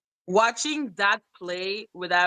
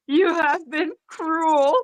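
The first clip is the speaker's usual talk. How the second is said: mock crying whine; 8 semitones higher, much slower than usual. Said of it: "I would cry and I was like".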